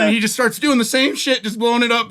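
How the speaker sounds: normal talking voice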